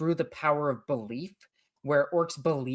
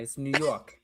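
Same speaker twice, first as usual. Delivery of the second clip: New York with a long drawn O; New York accent. Shown as a demonstration. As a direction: stretch the O in York. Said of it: "emphasize that O".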